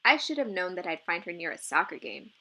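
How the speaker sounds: usual speaking voice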